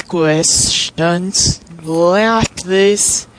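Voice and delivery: usual speaking voice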